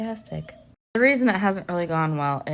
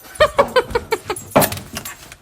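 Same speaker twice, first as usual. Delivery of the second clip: breathy giggle; 4 dB louder than usual. Real, not acted. Laughing after dog voice.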